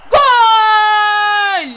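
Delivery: loud, high goal yell; goal shout. Real, not acted. Kid scoring in a football game, yelling goal. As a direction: roar the goal in triumph.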